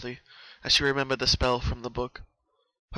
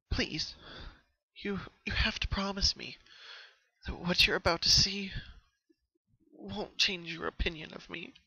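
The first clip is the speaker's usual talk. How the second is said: half-whispered, halting plea holding back a sob; hushed plea; 7 semitones higher than usual. A narrator's reading. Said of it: "holding back a sob".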